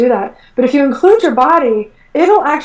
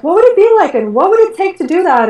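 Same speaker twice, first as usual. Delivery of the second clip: eager question; 7 semitones higher than usual. Retold or imitated. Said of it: "I was like".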